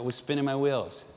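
usual speaking voice

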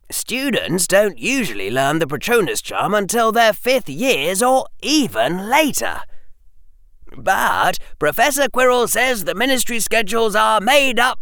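high, admiring schoolboy voice; awed worship. Acted. Harry talking about Professor Quirrell, awed worship. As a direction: gush about an idolised teacher, pitched high. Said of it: "usual tone of awed worship".

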